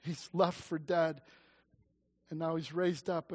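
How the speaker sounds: ordinary delivery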